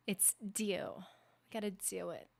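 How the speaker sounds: soft "do" said as "dew"; added EW sound. Shown as a demonstration. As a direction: put a y-glide in "do", softly. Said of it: "I add an E W to it".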